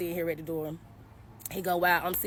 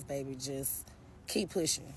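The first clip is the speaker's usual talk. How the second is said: tender encouragement; 4 semitones lower, 6 dB quieter than usual. Real, not acted. Encouraging girls who struggle.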